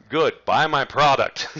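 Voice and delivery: blunt sales punchline. Pretend. Coach mocking pushy marketing to webinar students: loud, slow hard sell. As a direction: slam the pitch home with deadpan bluntness.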